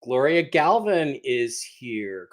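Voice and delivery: usual speaking voice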